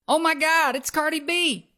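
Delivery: high-pitched starstruck gush; mock fangirl spotting her idol; retold thrill, pitched well up; imitating an excited fan. Fan spots a celebrity.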